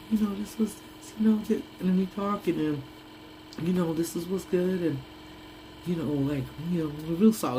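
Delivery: imitating a soft-spoken albino guy, just a hint. Streamer imitates the soft-voiced guy from the movie, light [name] type voice. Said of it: "like a [name] type voice".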